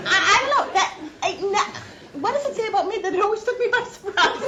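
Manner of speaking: real laughter breaking through the words; laughing. Real, not acted. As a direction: laugh helplessly while getting the words out. Laughing at father's joke.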